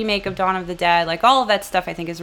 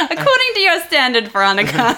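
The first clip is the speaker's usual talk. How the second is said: laughing; 7 semitones higher, 4 dB louder than usual. Real, not acted. Laughing while teasing.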